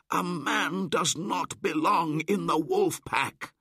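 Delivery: high, loud outraged yelp; angry cry. Acted. Young wolves crying out against Mowgli, angry. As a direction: yelp it out at the pack. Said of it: "cried most of the younger wolves angrily".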